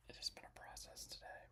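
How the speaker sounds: barely audible whispered aside; weary whisper. Real, not acted. Streamer admitting setup troubles to viewers, very soft whisper. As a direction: let it out under your breath.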